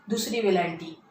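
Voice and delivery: ordinary talk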